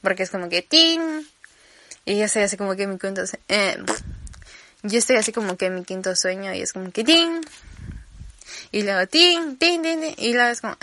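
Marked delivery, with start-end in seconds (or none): 0.6-1.2 phone ping. Retold or imitated. Imitating phone notification. 9.1-10.2 phone pings. Retold or imitated. Imitating repeated notifications.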